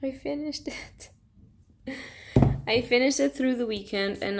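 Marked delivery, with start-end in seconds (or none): 0.0-1.1 drained. Real, not acted. Exhausted after finishing the book.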